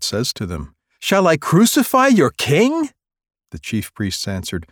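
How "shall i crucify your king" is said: much higher, louder rising question; loud high incredulous question; Pilate incredulous. Acted. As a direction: challenge the crowd.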